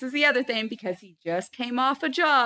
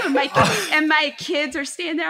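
usual speaking voice

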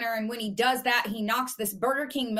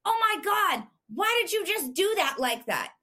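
retold scream; 7 semitones higher than usual. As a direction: imitate her scandalized outcry, pitched well up. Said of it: "She screams".

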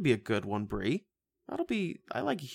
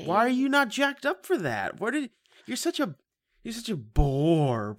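much higher, louder teasing outrage; mock outrage; 11 semitones higher, 4 dB louder than usual. Real, not acted. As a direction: act scandalized at her.

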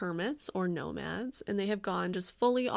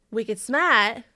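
loud, hammed-up Boston accent; New England accent; 2 semitones higher, 9 dB louder than usual. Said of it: "is the O G British accent".

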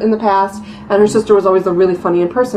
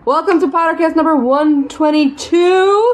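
high, slow announcer welcome; show intro; 9 semitones higher, slower than usual. Acted. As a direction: open the show like a host. Show opening.

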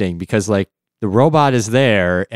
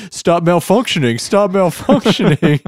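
laughing mock plea; 10 semitones higher, 5 dB louder than usual. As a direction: beg through laughter, higher and louder. Acting out the hitting victim.

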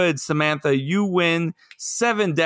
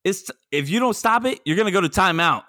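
higher-pitched scolding countdown; mock stern parent; 9 semitones higher than usual. Parent warning a child with countdown.

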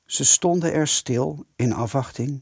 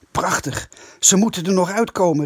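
raised, higher-pitched exclamation; excited call; 6 semitones higher, 4 dB louder than usual. A narrator's reading. Eddy tells the gang they're still inside, calling out eagerly. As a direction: call out eagerly, picking up speed. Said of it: "riep Eddy".

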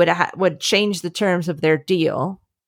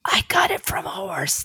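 whispered, pitched up high; triumphant whisper; 10 semitones higher, 5 dB quieter than usual. Shown as a demonstration. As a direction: lean in and whisper the tagline. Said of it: "But you have to whisper it".

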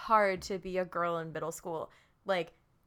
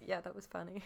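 understated retold reply; 9 dB quieter, faster than usual. Host imitates Tony answering his friend, soft and offhand. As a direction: toss it off quietly. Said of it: "And then Tony just goes".

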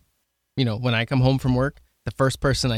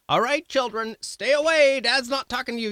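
high, sing-song mock announcement to children; exaggerated dad shooing the kids away; put-on, over-the-top high parental call; mock stern dad; 13 semitones higher than usual. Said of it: "I'm not telling them".

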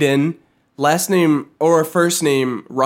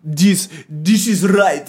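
slow, badly faked Russian accent; a clumsy stab at a Russian accent; failed Russian accent; 3 dB louder, much slower than usual. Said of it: "that was not even close".

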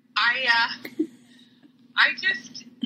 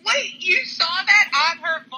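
shocked; 7 semitones higher than usual. Real, not acted. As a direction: blurt it out in disbelief. Shocked at the book question.

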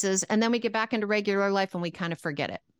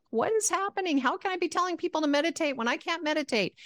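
high, exasperated wail; frustrated; 11 semitones higher than usual. Retold or imitated. Stuck in meditation, high frustrated complaint to herself. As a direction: throw up your hands in exasperation. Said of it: "I'm getting frustrated".

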